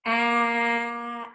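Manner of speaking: "At" with a long held vowel and separate T; sounded out slowly. Shown as a demonstration. Teacher sounds out word for children, "At" held long. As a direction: stretch "At" sound by sound. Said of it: "Let's check it slowly".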